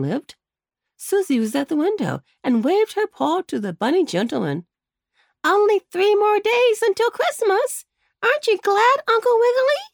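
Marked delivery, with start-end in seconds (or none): 5.4-9.8 put-on high, louder child's call; little rabbit girl calling. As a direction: call out eagerly to the bunny uncle. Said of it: "called Susie".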